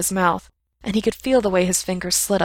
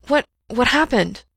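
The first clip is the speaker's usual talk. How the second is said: alarmed question; 5 semitones higher, 4 dB louder than usual. Acted.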